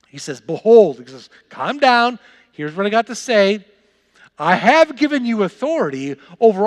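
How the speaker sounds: high, loud, fired-up preaching; preaching at full force. Acted. Pastor retelling the disciples' joy to his church, preaching high and loud. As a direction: pump the congregation up with the story.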